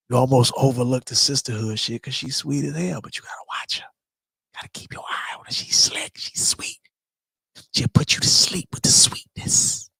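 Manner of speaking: conspiratorial whisper, unvoiced and hushed; a secret whispered to the chat. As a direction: lean in and whisper the warning. Whispered warning about Auntie Wanda.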